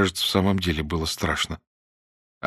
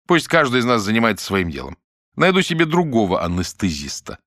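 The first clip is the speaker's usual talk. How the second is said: ordinary delivery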